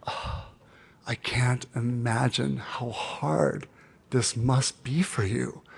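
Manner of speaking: soft slow whisper; whispered sympathy. Retold or imitated. Coach Debbie responding to his confession, softly whispered. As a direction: breathe it gently, slowly. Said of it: "And she went".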